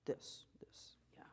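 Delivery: breathy whispered this; just air, no voice. Shown as a demonstration. Teacher whispers a thin-fold demonstration. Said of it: "helps with thin folds".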